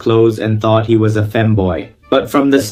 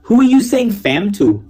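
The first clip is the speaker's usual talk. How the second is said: mocking; 11 semitones higher than usual. Real, not acted. Mocking reply to shout-out.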